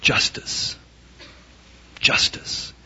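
a hushed mock prayer for justice; whispered demand. Preacher voicing a prayer for justice to the congregation, whispered.

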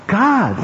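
"God" high, drawn out and falling; stress on God. Shown as a demonstration. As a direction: land hard on the word "God". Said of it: "put the emphasis upon God".

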